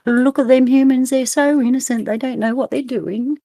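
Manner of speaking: a hint of an alien marvelling at humans; onlooking aliens. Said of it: "would they look at us and go".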